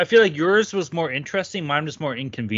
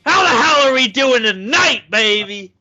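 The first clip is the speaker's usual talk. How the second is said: loud, high-pitched hype announcer; hype podcast intro; 6 semitones higher, 6 dB louder than usual. Acted. Host welcomes listeners to the hockey gambling podcast, shouted hype intro. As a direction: belt out the show opening with big energy.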